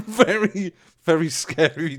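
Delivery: high, loud, breaking into laughter; real giggles between words; laughing. Real, not acted. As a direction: laugh through the repeated words. Laughing over mischief memories.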